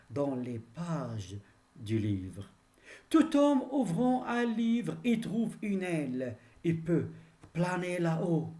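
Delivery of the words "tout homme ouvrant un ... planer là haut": high, sustained declaimed verse; ringing classroom declamation; poem recitation. Acted. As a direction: recite with a raised, carrying voice. Recites the verse between explanations.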